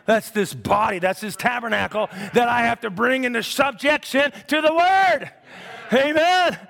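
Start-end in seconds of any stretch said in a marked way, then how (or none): 1.0-6.6 high, shouted revival preaching; pulpit-pounding sermon voice; fiery preaching. Acted. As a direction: preach it out full force to the church. Preaching at full force.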